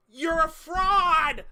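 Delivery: loud, slow, high accusation; slow furious shout. Real, not acted. Climax of streamer rant.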